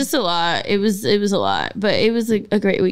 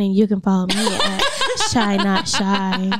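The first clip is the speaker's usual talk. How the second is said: sexy voice; 3 semitones lower than usual. Shown as a demonstration. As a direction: try to sound seductive. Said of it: "Why don't we ever put our sexy voice on?".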